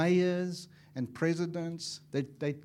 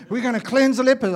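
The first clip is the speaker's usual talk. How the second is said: put-on louder, higher eager young preacher; a son sure he will heal lepers; 9 semitones higher, 15 dB louder, faster than usual. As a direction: boast it like a keen son. Sons voiced in retold talk.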